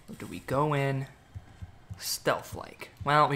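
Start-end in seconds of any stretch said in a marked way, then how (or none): 2.0-2.9 whisper. Acted. Sneaking into the map.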